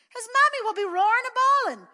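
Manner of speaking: put-on high, loud child's wail; a small boy wailing in distress; wailing little boy. A narrator's reading. Pat wails to mother.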